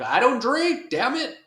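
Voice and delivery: high, loud, exasperated outburst; mock indignant Spawn yelling. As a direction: snap back high and loud, offended. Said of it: "And Spawn's like".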